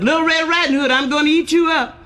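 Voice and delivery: high, excited storybook menace; big wolf threat. Acted. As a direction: pounce on the line as a comic big wolf.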